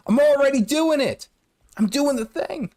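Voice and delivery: high voice cracking and breaking. Real, not acted. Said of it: "my voice is cracking".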